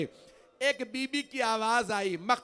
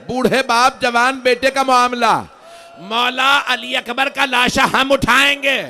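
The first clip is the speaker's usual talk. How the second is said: loud, high grieving wail; wailing cry; 5 semitones higher, 14 dB louder than usual. Acted. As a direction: cry it out to the mourners, full voice. Majlis climax, loud wailing recitation. (بلند آواز میں گریہ)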